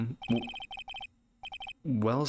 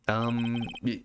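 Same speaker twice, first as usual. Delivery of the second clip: ordinary talk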